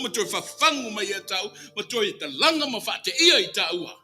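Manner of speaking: usual speaking voice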